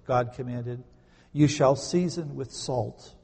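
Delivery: measured, deliberate Bible reading; scripture reading. Acted. Pastor reads God's command to the congregation, solemn reading. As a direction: read the command with weight.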